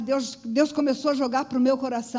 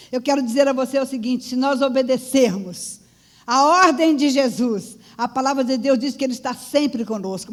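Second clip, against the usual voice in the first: loud, insistent preaching; rally the church with forceful emphasis; pregação enfática; 3 semitones higher, 7 dB louder than usual. Real, not acted. Exorta a igreja com força. (pregação forte e enfática, exortando a igreja)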